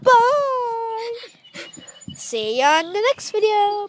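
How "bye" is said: called goodbye. Real, not acted. Calling goodbye.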